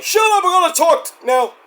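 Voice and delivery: high-pitched, hammed-up command; mock wrestler outburst. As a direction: imitate a wrestler silencing the crowd. Acts out wrestler hushing the crowd.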